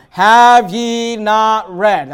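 each word stretched, very slowly and loudly; slow emphatic quote. Acted. Pastor quoting Jesus' rebuke to the Sadducees, drawn out and loud. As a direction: lean hard on "Have ye not read?".

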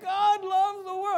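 high, drawn-out imitation of a pleading woman; hammed-up slow, high protest; mock dramatic. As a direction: mimic someone getting all dramatic. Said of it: "she, like, gets all dramatic".

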